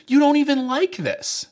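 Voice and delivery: put-on high, scolding little girl voice; older sister. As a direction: needle her brother, high and sharp. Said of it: "The older sister looks at him and says".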